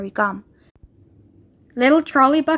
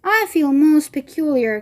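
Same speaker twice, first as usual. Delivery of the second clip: usual speaking voice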